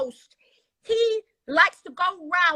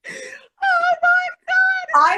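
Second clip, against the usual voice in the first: very slow, high, loud drawn out oh my God; laughing wail; laughing cry; 13 semitones higher, 7 dB louder, much slower than usual. Real, not acted. Overwhelmed laughing.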